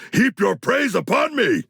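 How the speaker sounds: triumphant shout. Acted. Boasting in battle.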